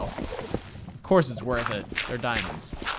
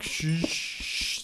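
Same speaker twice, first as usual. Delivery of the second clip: imitated tyre-skid hiss, voiceless; skidding noise; 5 semitones higher than usual. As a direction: make a long whooshing skid sound. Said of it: "I'm just like".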